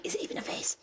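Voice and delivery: soft, voiceless mock menace; whispered taunt. Reader taunting the imagined victim, whispered menace. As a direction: whisper the question as a pretend killer.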